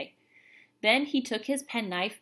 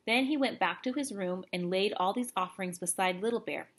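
ordinary delivery

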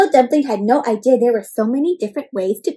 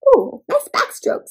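Tortellini's praise; 8 semitones higher, slower than usual. Acted. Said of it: "says Tortellini".

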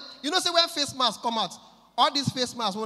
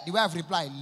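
ordinary delivery

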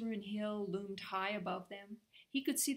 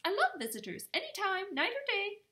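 very high and louder, fussy and eager; fussing Timmerie; 12 semitones higher, 7 dB louder than usual. Acted. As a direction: flutter around him with a high, eager plea. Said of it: "Timmerie fussed".

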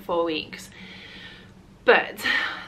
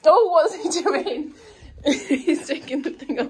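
laughing; 8 semitones higher, 4 dB louder than usual. Real, not acted. Laughing while watching the baby.

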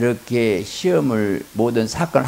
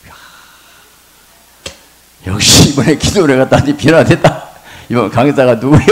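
loud, high pleased exclamation; delighted husband; 3 semitones higher, 9 dB louder than usual. Retold or imitated. Husband delighted with his changed wife. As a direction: sound thrilled by the change.

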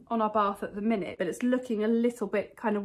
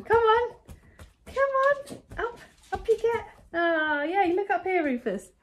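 much higher, louder sing-song dog coaxing; 11 semitones higher, 5 dB louder than usual. Real, not acted. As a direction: coax the pet up onto the sofa in a high voice.

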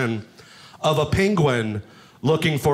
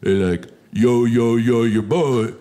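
Sylvester Stallone voice; 5 semitones lower than usual. Acted. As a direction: slur it low like Rocky. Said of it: "like Sylvester Stallone".

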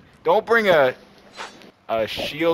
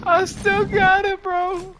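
excited; slower than usual. Real, not acted. Clutch defuse under pressure.

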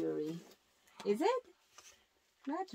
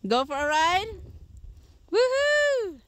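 calling out; 11 semitones higher, 5 dB louder than usual. Real, not acted. Calling to passers-by outside.

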